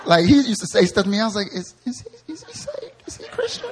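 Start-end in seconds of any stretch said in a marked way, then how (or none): 1.5-3.7 much higher, soft squeaky disbelief; shocked squeak. Retold or imitated. Pastor mimics his naive younger self about [name], high squeaky disbelief. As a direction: squeak it out like a scandalized kid.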